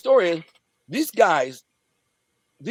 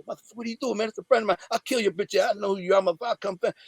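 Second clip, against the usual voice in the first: higher-pitched retold tough-guy threat; threatening act, voice pitched up; mock threats; 6 semitones higher, faster than usual. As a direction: act out a staged threat, no extra volume. Staged threats to the thugs.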